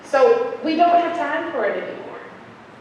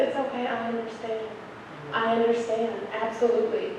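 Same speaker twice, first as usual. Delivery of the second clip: reassuring; 7 dB quieter than usual. Retold or imitated. Soothing the crying teacher.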